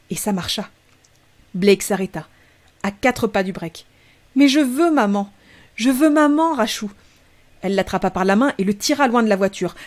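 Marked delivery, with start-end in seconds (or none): 4.4-6.9 put-on higher, whiny child wanting mum; whining little boy. Blake whines to his sister that he wants mum, high and plaintive. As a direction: plead like a small child near tears.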